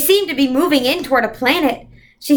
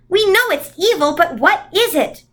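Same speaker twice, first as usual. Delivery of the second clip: demanding; 8 semitones higher than usual. Acted. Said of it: "Charles demanded".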